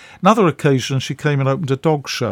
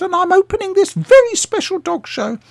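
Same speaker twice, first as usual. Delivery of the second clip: much higher put-on voice of an elderly actress, full of importance; Patricia Hayes; 5 dB louder than usual. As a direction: pitch it up high and grand. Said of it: "she said".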